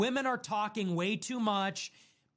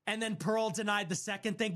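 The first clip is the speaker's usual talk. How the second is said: ordinary talk, nothing marked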